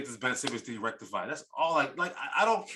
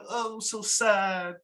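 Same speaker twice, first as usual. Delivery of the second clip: put-on whiny Australian accent; 6 semitones higher than usual. Host mocks player begging to be saved, whiny Australian accent. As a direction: moan for rescue in a hammed-up Australian accent. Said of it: "say it with an Australian accent".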